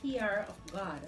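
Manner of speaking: normal talking voice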